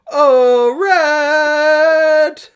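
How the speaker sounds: high, loud, long-held call; yelling. A narrator's reading. Link calls for Rhett in the story, drawn-out yell. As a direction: yell it out, stretching the name. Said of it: "Link yell".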